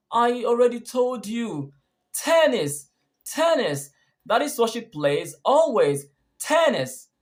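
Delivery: high, insistent, punching each "tennis"; stress on tennis. Shown as a demonstration. Answering someone who asked again, emphatic stress on "tennis". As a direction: hammer "tennis" as its own word each time. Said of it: "You would have stressed tennis".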